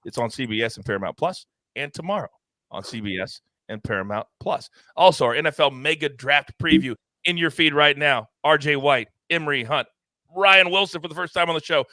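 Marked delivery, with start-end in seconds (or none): none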